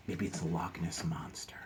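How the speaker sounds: soft whisper of suspense; hushed guess. Pretend. Reader guessing at the sea creature, hushed whisper. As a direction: whisper the suspicion.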